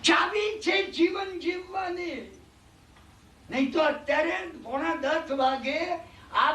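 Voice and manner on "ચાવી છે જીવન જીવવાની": slow, drawn-out stress on છે; emphatic sermon line. Acted. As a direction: lean hard on છે, slowly. Preacher stresses key of life.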